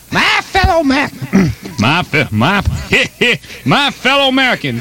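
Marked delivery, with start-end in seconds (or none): 0.1-1.1 high, halting attempt at a presidential address; Richard Nixon. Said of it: "how I used to talk". 3.7-4.8 high-pitched mock presidential address; Richard Nixon. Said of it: "just the way I used to sound".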